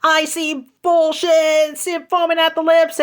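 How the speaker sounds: high, mocking imitation of a ranting man; mocking his rant. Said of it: "saying, like".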